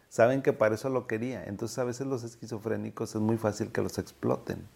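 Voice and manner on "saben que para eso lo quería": normal talking voice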